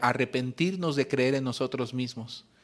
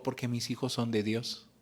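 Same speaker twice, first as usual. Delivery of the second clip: quiet conviction; 3 semitones lower, 8 dB quieter than usual. Retold or imitated. Mother answers with quiet faith.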